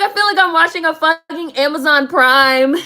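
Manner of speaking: loud, high exclamation; incredulous outburst. Retold or imitated. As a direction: exclaim it loudly, on the edge of laughter. Retelling own outraged reaction to cast.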